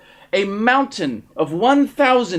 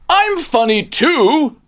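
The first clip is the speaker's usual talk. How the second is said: a slow, loud, higher declaration; declared; 8 semitones higher, 7 dB louder, slower than usual. A narrator's reading. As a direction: announce it proudly and slowly. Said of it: "declared Rocket Spaceman".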